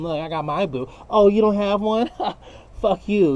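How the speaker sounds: mock gloating taunt; mocking boast. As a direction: rub it in like a smug show-off.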